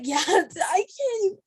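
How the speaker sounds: high-pitched mock American voice; hammed-up, high California imitation; imitated California talk. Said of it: "just went like".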